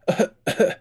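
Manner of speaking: loud, put-on cough; showy cough. A narrator's reading. De Verck fakes a chill, loud ostentatious cough. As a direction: cough for show, overdone. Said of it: "He coughed ostentatiously".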